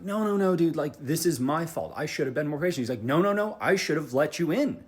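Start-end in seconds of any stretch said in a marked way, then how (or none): 0.0-2.8 loud, high-pitched retold protest; insistent protest. Driver insisting to the other driver it's his fault, loud insistent protest. Acted insistent apology on phone. 3.0-4.8 loud, high imitated protest; insistent counter-protest. Other driver arguing that he is to blame, loud insistent protest.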